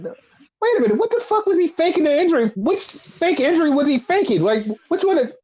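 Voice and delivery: high-pitched incredulous questioning; replaying bafflement, fast burst then fumbling; lost, confused. Host re-enacts losing track of the fake injury, confused and high-pitched. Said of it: "I was so lost for a second".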